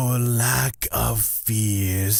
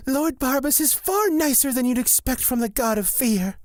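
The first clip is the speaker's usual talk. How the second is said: rushed; much faster than usual. Acted. Fast high explanation.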